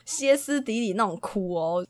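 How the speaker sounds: ordinary talk, nothing marked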